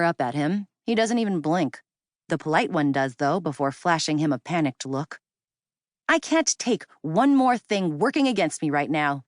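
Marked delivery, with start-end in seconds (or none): none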